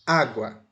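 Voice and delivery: "água" with a loud, forceful first "á"; stressed first syllable. Shown as a demonstration. Teacher giving an example of spoken accent, "água" stressed on "á". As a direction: hit the "á" of "água". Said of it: "uma sílaba que eu vou botar mais força".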